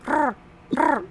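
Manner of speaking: crow caw. Pretend. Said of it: "crow impression".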